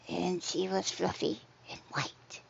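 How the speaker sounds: hushed, whispered story voice; spooky whisper. Pretend. Streamer teasing a spooky story, whispering. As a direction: breathe it out like a bedtime tale. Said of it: "I'll start telling spookies".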